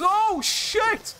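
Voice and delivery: real high-pitched startled cry; startled yelp. Real, not acted. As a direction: yelp it out in sudden alarm. Sudden scare during play.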